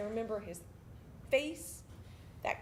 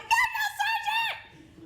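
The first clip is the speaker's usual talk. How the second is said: high, drawn-out recruit yell; shouted refusal; 7 dB louder, much slower than usual. Retold or imitated. Recruit suspecting mind games refuses sergeant's coffee, shouting. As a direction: yell the refusal at full volume.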